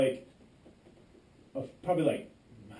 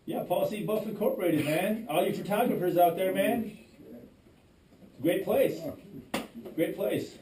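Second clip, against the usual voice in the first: sarcastic shout out; 7 semitones higher than usual. Real, not acted. Sarcastic plug for the company that fired him. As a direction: sell it with dry irony.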